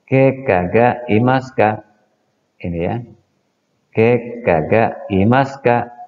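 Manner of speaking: Ke kaga imaska, slow with a lift on ke; slow model sentence. Shown as a demonstration. Teacher modelling the orthopedist question: slow and clear. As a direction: drill the question word by word. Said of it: "Kita ulangi".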